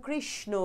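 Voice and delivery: কৃষ্ণ split as krish-no; krish, then no, for কৃষ্ণ; demonstrated pronunciation. Shown as a demonstration. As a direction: sound a clear n at the end of কৃষ্ণ. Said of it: "ন উচ্চারণ করেছি".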